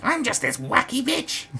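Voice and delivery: high-pitched, grating mock impression; screechy hammed-up caricature; annoying voice. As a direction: mimic her as an irritating wacky woman. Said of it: "she's got that annoying voice".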